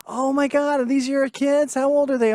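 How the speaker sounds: high, gushing suburban mom; a lightly voiced gushing neighbor. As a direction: coo over the kids like a neighbor. Suburban mom gushing over kids.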